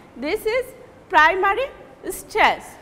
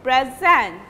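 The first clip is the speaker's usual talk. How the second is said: "present" pitched low, its second syllable louder; second-syllable stress; 4 semitones lower than usual. Shown as a demonstration. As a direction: lean hard on the end syllable of "present". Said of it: "pronouncing loudly the second syllable".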